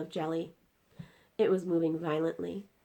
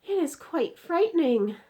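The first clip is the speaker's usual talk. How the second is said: put-on high, loud, scared girl; a touch of fear; girl frightened by the nightmare; frightened cry; 11 semitones higher, 9 dB louder than usual. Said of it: "Sophie cried".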